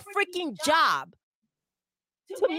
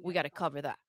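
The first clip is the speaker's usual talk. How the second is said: insurance agent voice; 10 semitones lower, faster than usual. Retold or imitated. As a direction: sink the voice like a resigned insurance clerk. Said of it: "They'll be like".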